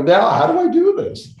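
high, loud and laughing through it; giddy, can't-believe-my-luck laugh; retelling a lucky break, laughing high and loud; laughing disbelief. Retold or imitated. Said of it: "I laughed".

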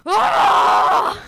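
high, loud imitated kid scream; mock scream of a drugged little boy; kid's scream. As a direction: let out a sudden piercing yell. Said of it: "he screams really loud".

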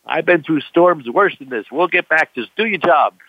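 high, firm command; mock stern order. Skipper ordering the panicking crewman, stern. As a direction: snap him back to work.

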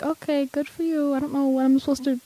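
softly voiced, nonplussed dad; unimpressed dad. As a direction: lightly imitate a dad humoring his kid. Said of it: "telling my dad, he's like".

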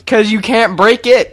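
loud, high, slow comeback; emphatic retort. Real, not acted. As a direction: fire back in defence of your truck, stretching the words. Defending the truck in argument.

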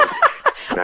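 sudden high-pitched burst of laughter; a real, high, delighted laugh; big high laugh. Real, not acted. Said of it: "let me have my laugh".

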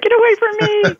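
squeaky high mock voice of a stranger recoiling; mock shunning stranger. Mock reaction of people shunning.

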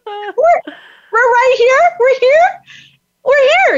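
can't believe we're right there; rising, near-shouted amazement; excited disbelief. Real, not acted. Shock at finding the house.

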